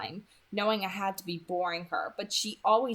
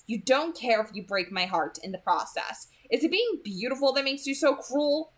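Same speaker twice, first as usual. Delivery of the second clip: acted anger; 6 semitones higher than usual. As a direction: play the wounded, furious boy. Staged breakup rant continues.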